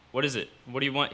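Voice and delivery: ordinary delivery, nothing marked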